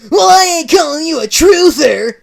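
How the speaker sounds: loud, high furious rant; ranting Sonic. Acted. Sonic retorts.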